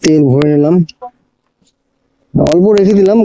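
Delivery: normal talking voice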